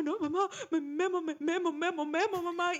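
lightly voiced scared little girl; frightened child. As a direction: blurt "Memo" over and over, breathless with fright. Scared child blurting to mother.

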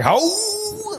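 a sudden very high shocked "Oh"; shocked gasp. Retold or imitated. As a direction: gasp it out like you can't believe the bet. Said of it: "And we're like".

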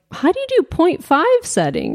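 lightly voiced baffled man, pitched high; mock clueless question. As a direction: imitate someone puzzled by a phone, high-pitched. Imitates clueless celebrity asking about camera.

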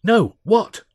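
loud, high, keen question; eager. A narrator's reading. Alf begs to hear the idea, eagerly. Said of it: "Alf said eagerly".

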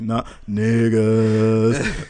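drawn-out mock zombie refusal. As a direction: stretch it out slowly and loudly, as a zombie turning away.

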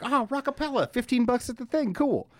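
high, easygoing impressed fan; pleasantly surprised, pitched well up; casual ticket buyer. Acted. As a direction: toss it off high, like a happy impulse buy.

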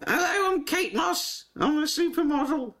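high-pitched, silly model voice; badly faked supermodel squeak; Kate Moss. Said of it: "supposed to do Kate Moss".

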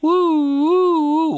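high wailing siren imitation; American siren woo. Shown as a demonstration. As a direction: wail like an American police siren. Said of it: "Is it the American".

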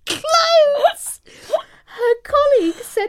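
can barely get it out for laughing. Real, not acted. Telling the poo story to her co-host, cracking up.

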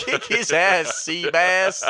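slow, stretched quote; movie quote voice. Retold or imitated. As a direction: drag out the ass. Repeating film line.

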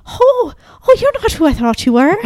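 high-pitched imitation Irish accent; Irish old lady. Old lady at the wrong car, high Irish accent. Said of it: "most beautiful Irish accent".

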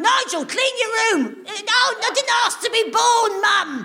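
put-on nagging mum then whiny teen son, high and slow; hammed-up nagging parent and sulky son; parent and grown son. Parent and son row acted out.

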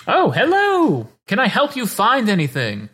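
sweet shopkeeper welcome; shopkeeper greeting. Acted. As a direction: shopkeeper greets two teen customers, high and sing-song.

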